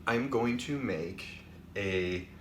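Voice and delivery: normal talking voice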